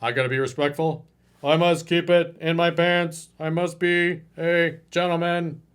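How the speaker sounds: stiff, prim gentleman voice; mock the respectful nice guy. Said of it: "he's gonna".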